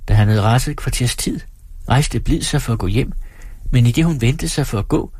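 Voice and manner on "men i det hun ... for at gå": usual speaking voice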